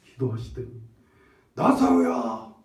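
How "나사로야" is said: calling Lazarus high and loud; Jesus calling dead Lazarus out of the tomb with a loud cry. Acted. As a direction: shout the name into the tomb. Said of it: "외치시는 거예요".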